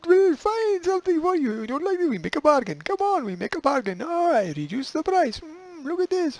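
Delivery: a street seller who won't let you walk past; pushy market vendor. Pretend. Market seller coaxing a passing customer, high and pushy. Vendor hawking goods to ladies.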